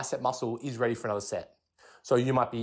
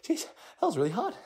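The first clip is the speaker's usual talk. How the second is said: much higher, breathy whew of a spent lifter; out of breath. Retold or imitated. Lifter just racked the bench press, imitating being winded. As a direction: blow out the relief after a brutal set. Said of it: "I might be like".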